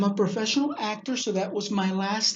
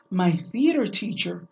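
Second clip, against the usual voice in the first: ordinary delivery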